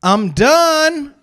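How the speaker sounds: high, loud child's holler, imitated; kid calling out. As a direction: call from the bathroom like a small child. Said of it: "I just hear".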